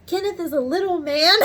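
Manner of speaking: high, loud punchline bursting into laughter; cracking up. Retold or imitated. Retelling the supervisor's line about Kenneth, cracking up. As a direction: barely get it out before the laugh.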